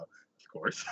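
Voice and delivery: ordinary delivery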